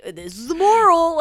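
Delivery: slow, high, loud melodramatic line. Retold or imitated. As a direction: deliver it like a grand silent film narrator. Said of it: "That's my melodrama voice".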